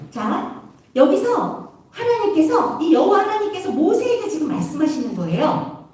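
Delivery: ordinary talk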